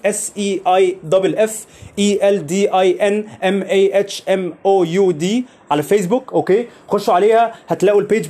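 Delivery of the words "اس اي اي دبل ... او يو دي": letters spelled out one by one, slowly; slow spelling. Shown as a demonstration. Host spelling his Facebook page name for listeners, slowly. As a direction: dictate each letter slowly and clearly.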